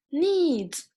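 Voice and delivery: "needs" stretched high, then falling, with the final dz clear; drawn-out example word. Shown as a demonstration. Teacher showing students where zi hides in English, drawn-out example word. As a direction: draw out "needs" to show its ending. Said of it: "this part, zi".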